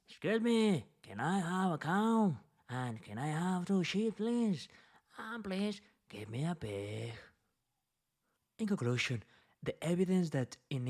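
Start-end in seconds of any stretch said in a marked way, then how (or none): 0.1-7.1 peasant asking. Retold or imitated. Lecturer voicing poor peasants asking for animals, higher peasant voice. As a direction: call out like a plain peasant. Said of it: "While the people, the peasants, the poor people, liked and preferred".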